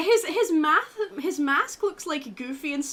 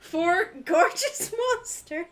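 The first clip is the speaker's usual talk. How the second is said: laughing; 5 semitones higher, 5 dB louder, much slower than usual. Acted. Reading dating sim text, laughing.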